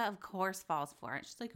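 normal talking voice

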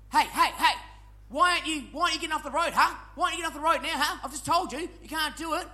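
lightly voiced irate driver; a hint of a nagging taxi driver; mock angry taxi driver.

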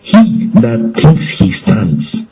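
scripture quote. Shown as a demonstration. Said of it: "the Bible says".

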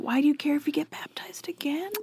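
quick whispered question. Pretend. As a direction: whisper it fast, conspiratorial. Whispered aside.

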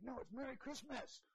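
imitating grandma fired up over Christmas. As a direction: correct them like a riled-up grandma. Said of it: "She'd be like".

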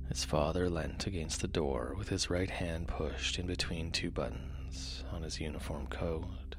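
soft, low bedtime reading; sleep story narrator. A narrator's reading. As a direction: read gently to lull listeners to sleep.

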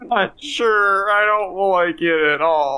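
high, drawn-out whimper; hammed-up weepy voice; tearful. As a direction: whine it slowly, on the verge of tears. Character upset at apparently killing Santa.

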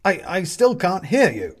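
high, loud, stumbling shout; calling again. A narrator's reading. More jumping and waving.

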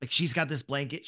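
usual speaking voice

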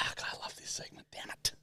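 unvoiced hushed aside; whispering. Real, not acted. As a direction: whisper it to yourself.

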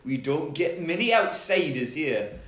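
broad Geordie lilt; Geordie velociraptor. Acted. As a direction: play a menacingly polite Geordie dinosaur. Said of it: "he might be a Geordie Velociraptor".

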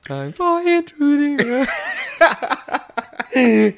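very slow, high, laughing. Retold or imitated. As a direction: stretch it out mid-laugh. Mock dramatic retelling, laughing.

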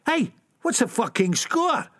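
ऊँचे सुर में चुनौती; झगड़ालू ऊँचा सवाल; challenging lad. Acted. Shell suit guy challenging.

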